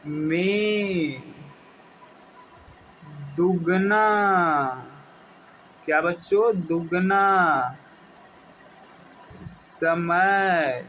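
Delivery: drawn-out, word-by-word dictation for notes; teacher dictating at a crawl; slow dictation. Acted. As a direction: stretch each word so the class can write it down. Teacher dictates second definition.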